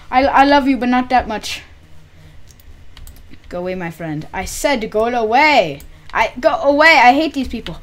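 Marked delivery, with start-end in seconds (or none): 0.1-1.6 loud yell. Real, not acted. Yelling at villager. 4.3-7.8 loud, high shout to go away; frustrated shout. Real, not acted. Yelling at villager.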